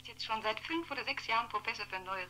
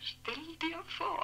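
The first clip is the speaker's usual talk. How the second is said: gossip's stretched exclamation; drawn-out mock astonishment; slow, higher mock-scandalised imagine; 12 semitones higher, much slower than usual. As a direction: draw it out, higher, in mock shock.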